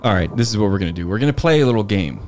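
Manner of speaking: normal talking voice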